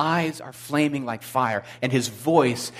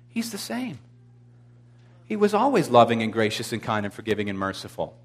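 normal talking voice

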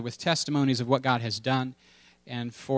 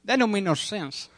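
Delmar impression; 7 semitones higher than usual. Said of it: "That's my Delmar".

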